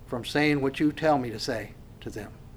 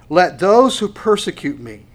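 louder, pressed verse reading; preaching; 4 semitones higher, 7 dB louder than usual. Acted. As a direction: proclaim the verse. Reads the verse forcefully.

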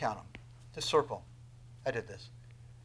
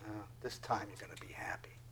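quick, intimate whisper; 6 semitones lower, 12 dB quieter, faster than usual. Real, not acted. Preacher promising happiness, whispered intimately. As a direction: confide it in a whisper.

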